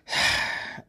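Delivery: exasperated breath out; annoyed sigh. Acted. God interrupted by Adam calling him, annoyed sigh. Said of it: "rather annoyed".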